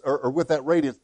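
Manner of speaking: ordinary talk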